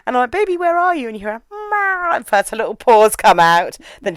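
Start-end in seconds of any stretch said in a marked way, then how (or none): 0.3-1.1 high, sing-song call into the house; calling the cat. Retold or imitated. Owner calling for her cat, high and coaxing. 1.5-2.1 small, high mock meow; cat meow. Cat answering her owner from inside the box, high meow.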